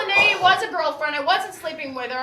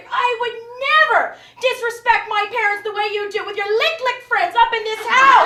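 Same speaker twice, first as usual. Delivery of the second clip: loud, high scolding mother; hammed-up scolding; indignant mom; 7 semitones higher, 9 dB louder than usual. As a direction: lecture like an offended mom. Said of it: "'cause she said".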